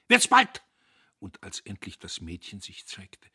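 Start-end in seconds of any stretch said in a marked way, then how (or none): 0.1-0.6 very high, loud prod; explosive impatient demand; impatient shout. A narrator's reading. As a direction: yell to hurry them up. Impatient shouted order to workers.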